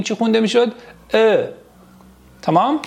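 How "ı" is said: «ا» as Turkish ı, a dull unrounded vowel; dotless ı sound. Shown as a demonstration. Teacher models dotless i for students, dull ı. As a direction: say «ا» as "uh", tongue high and back. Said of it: "آیه بدون نقطه داریم".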